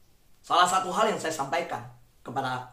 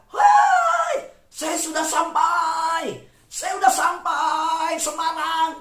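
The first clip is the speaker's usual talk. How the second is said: high, loud, drawn-out holler; gleeful shout; 10 semitones higher, 8 dB louder, much slower than usual. Retold or imitated. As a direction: yell it out like a kid from a car window. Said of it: "Dia teriak, saudara".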